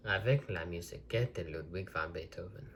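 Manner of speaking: a self-conscious try at a French accent; French accent attempt. Shown as a demonstration. Vlogger reading a French tape cover to viewers, in an amateur French accent. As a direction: read the French line in your best French accent. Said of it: "I'm gonna try and do my best French accent".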